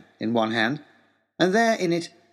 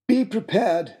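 a higher, lightly voiced pompous father; father's voice; 6 semitones higher, slower than usual. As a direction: play the father proudly quoting his motto. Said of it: "is what he said".

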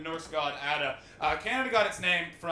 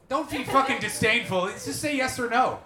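loud, higher-pitched exasperated demand; raised-voice heckle back at the audience; loud scolding; 6 semitones higher, 7 dB louder than usual. Real, not acted. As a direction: scold the crowd at full projection. Host scolds an unresponsive audience member.

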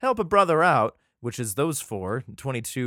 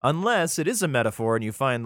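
ordinary talk, nothing marked

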